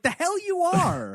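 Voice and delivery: high-pitched, indignant comeback; incredulous retort. Retold or imitated. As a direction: call his bluff in mock outrage. Retorting to the film character.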